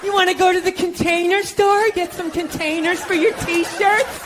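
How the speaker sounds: put-on a girlfriend pitching plans, sugary; mock girlfriend suggestions. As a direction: chirp the suggestions sweetly.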